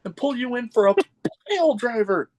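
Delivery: high, hammed-up wrestler's threat; wrestling promo. Podcaster mimicking a wrestler's ring promo, high mock threat. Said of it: "he did the, the promo in the ring".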